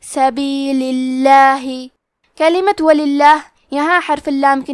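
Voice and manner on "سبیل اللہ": "سبیل اللہ" with a light, thin lam, slow. Shown as a demonstration. Teacher shows the light lam of Allah, slow and thin. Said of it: "حرف اللام کو پتلی آواز میں پڑھیں گے".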